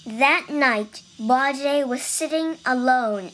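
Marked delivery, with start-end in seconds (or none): none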